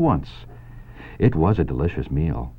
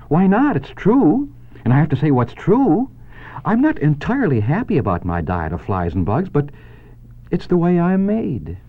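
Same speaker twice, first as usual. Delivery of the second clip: much higher, firm spider voice; a spider calmly defending her nature, pitched up; spider Charlotte; 9 semitones higher than usual. Acted. Charlotte answering Wilbur, high and matter-of-fact.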